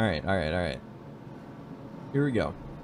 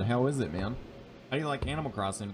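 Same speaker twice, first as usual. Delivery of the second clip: ordinary talk, nothing marked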